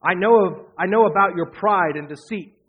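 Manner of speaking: mock him with contempt. A narrator's reading. Older brother mocking David's motives. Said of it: "Now he's making fun of him".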